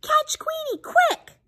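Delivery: very high squawked alarm; quacking duck. Acted. Noisy duck rallies animals to catch the dog, high quacking cry. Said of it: "quacks Dottie".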